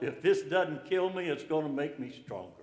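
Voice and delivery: ordinary talk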